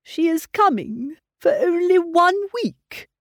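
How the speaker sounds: slow, mildly downcast lament; a father sighing over the short visit; depressed tone. A narrator's reading. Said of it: "in a very depressed tone".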